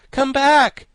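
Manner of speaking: very high, loud shout. A narrator's reading. Boy calls the flying Thing back, shouting. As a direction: shout it up into the air. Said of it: "shouted the boy".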